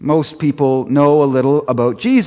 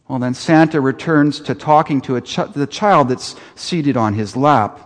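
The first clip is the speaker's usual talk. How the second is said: normal talking voice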